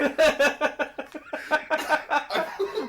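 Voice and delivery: long, loud, high-pitched cackle; real squealing laughing fit; helpless laugh. Real, not acted. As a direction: burst out in uncontrolled laughter. Cracking up at their mistake.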